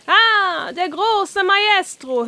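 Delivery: the carp cheering Oskar after he sings; excited call. Acted. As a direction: cheer him like a fan. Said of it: "aufgeregt rufen".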